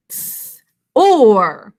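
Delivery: a voiceless Ts, then a loud, sharp or; sound demo. Shown as a demonstration. Teacher isolating the sounds of Thor, a breathy Ts then a loud or. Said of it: "two sounds".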